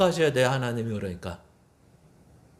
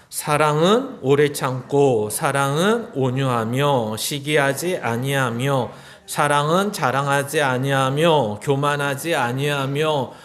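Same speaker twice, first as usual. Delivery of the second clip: scripture reading; 4 semitones lower than usual. Acted. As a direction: read each line weighty and steady. Reads scripture aloud.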